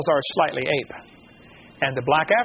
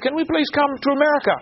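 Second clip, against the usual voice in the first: pleading refugees; 8 semitones higher than usual. Retold or imitated. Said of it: "They said".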